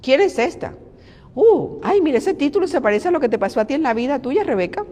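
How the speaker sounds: high, mocking busybody voice with sharp shrieks of "Ay"; catty gossip. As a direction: play a nosy envious woman fussing over an accent mark. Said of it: "Y empezaron a hablar".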